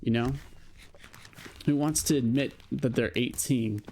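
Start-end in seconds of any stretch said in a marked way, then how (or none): none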